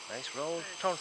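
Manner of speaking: rising excitement as the putt rolls; golf commentator. Real, not acted. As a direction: call the putt, building. Calling a rolling putt live.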